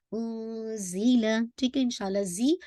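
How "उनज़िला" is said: उनज़िला chanted slowly with the न hidden lightly; hidden noon. Shown as a demonstration. Teacher shows light ikhfa to the class, slow chant. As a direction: glide through उनज़िला, noon half hidden. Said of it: "आपने इख्फ़ा light करना है".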